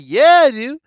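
high, loud eager yes; excited. Retold or imitated. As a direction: jump at the offer. Eager reply to contest.

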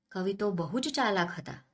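plain reading voice

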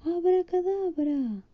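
high, slow drawn-out magic word; the witch Winnie shouts her spell, high and slow; witch's spell call. Acted. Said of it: "y gritó".

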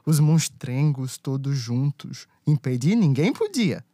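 rhythmic rhyming verse storytelling; cordel recitation. A narrator's reading. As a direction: recite like a folk poet.

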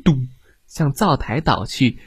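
ordinary delivery

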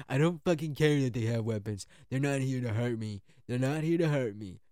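Trump voice. Retold or imitated. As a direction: bark it like an impatient boss.

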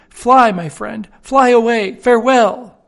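canary urging its friend to escape, high and loud; urgent canary. Acted. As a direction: push the friend out the window. Canary urging the bird to flee.

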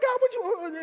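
flustered prophet. Retold or imitated. Preacher voicing the prophet protesting to God, high squeaky panic. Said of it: "It's like".

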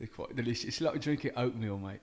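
lightly done cockney bloke, mate-style; put-on British accent. Guest on the show, answering in a light mock British accent. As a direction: play a chatty Brit. S0 plays British character Welvin.

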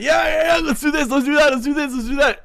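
high, loud excited babble; mock-hyper, racing through every idea at a high pitch; overexcited. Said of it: "you're getting me too excited".

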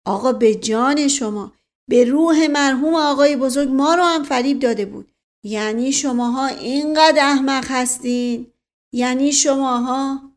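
heated, incredulous appeal; indignant protesting nephew. Acted. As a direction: protest your innocence in exasperation.

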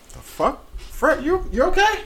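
put-on high, incredulous girlfriend voice; whiny ex impression. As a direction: squeak it out like a worried girlfriend. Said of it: "my ex was like".

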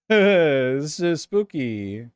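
very slow, drawn-out ghost-story voice; hammed-up haunted-house voice; mock spooky voice. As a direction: stretch every word like telling a ghost tale. Repeats spooky in a put-on voice.

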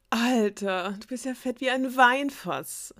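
high, slow teasing voice of a spoiled princess; mocking princess. A narrator's reading. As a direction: sneer at the suitor from up high. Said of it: "lachte die Königstochter einen der Männer aus". (verspottende Prinzessin mit hoher Stimme)

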